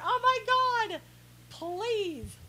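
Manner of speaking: high-pitched, incredulous plea; drawn-out, high exasperation; exasperated. Real, not acted. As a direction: throw up your voice in disbelief. Mock exasperation at humorless critics.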